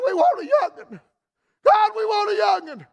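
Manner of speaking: put-on an old man's prayer climbing to a desperate plea; pleading cry. Old couple's prayer, rising each decade.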